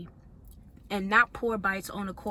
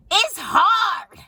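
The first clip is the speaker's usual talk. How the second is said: higher and louder, a sudden cry; exasperated outburst; 12 semitones higher, 14 dB louder than usual. Real, not acted. Venting to viewers about the pressure of keeping up, exasperated and loud. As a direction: blurt it out, overwhelmed.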